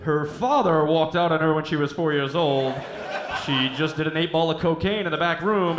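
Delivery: loud club MC reading a sad backstory; strip club DJ. Pretend. Strip club MC gives dancer's grim history, loud announcer. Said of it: "Papa's gonna bring it home".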